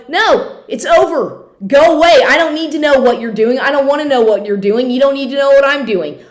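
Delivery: real anger at the man leaving notes; firm angry refusal. Real, not acted. Telling off her stalker.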